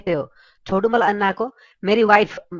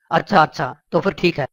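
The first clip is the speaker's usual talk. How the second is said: deep, lower police commissioner voice; gruff police officer; 6 semitones lower than usual. Acted. Commissioner phones Billa about a complaint, low and official. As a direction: sound like a stern cop on the phone.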